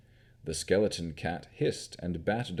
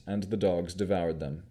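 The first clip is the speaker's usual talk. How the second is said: plain reading voice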